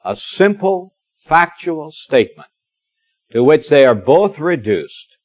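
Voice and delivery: ordinary talk, nothing marked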